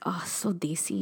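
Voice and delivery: hushed unvoiced whisper; whispering delight at the food photo. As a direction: breathe it out softly like a secret. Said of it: "which I was just like".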